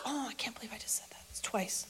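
hushed, hurried self-reproach; horrified whisper to self. Acted. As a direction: whisper it privately, aghast. Peter aghast, talking to himself.

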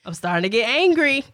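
a playful warning of getting angry; hammed-up rising annoyance; mock warning. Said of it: "My warning".

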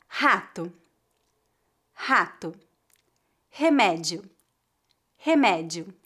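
"Rato" with a throaty h-like R from the throat; teacher models the guttural R, then says "rato" again. Shown as a demonstration. Teacher models words for students to repeat, throaty R. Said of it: "Eu vou dizer cada palavra duas vezes".

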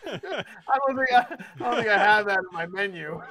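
fast, laughing; laughing while joking. Real, not acted. Player laughs at the taste check.

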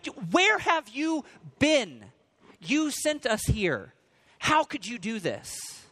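acted accusing outburst, high and drawn out; angry. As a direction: demand answers, slow and high-pitched. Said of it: "I can see being angry".

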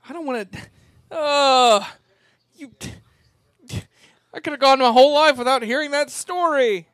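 high, loud and disgusted; disturbed. Real, not acted. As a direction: squeal in horrified disbelief. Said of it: "J B, you look disturbed".

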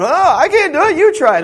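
a frantic disciple pushing the job onto a friend; hammed-up flustered disciple shoving off the task; panicky disciple. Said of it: "They were too busy going".

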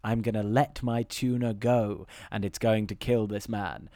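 deep, grave and deliberate; mock menacing captain; low solemn villain voice. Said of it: "And he's like".

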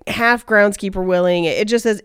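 ordinary delivery

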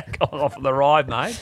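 laughing mock Australian echo; playful Aussie coach voice through a laugh; Eddie Jones Aussie impression. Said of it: "so many impressions ... of Eddie Jones".

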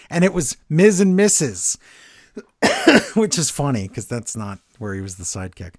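ordinary delivery

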